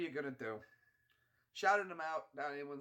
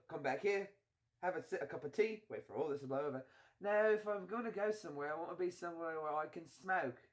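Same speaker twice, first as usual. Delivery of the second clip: brisk British film-hero voice; put-on British accent. Friend laying out a rescue plan, British accent.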